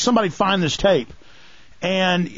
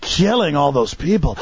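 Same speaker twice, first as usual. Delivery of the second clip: ordinary talk, nothing marked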